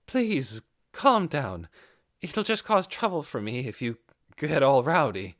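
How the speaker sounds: calm him down, a little embarrassed; awkward. A narrator's reading. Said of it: "said awkwardly".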